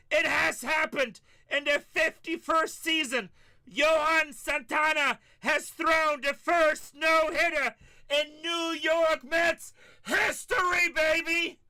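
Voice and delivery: shouted, high, slow triumphant radio call; Gary Cohen announcer call. Retold or imitated. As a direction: roar it like the no-hitter just ended. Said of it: "'cause I get to talk like that".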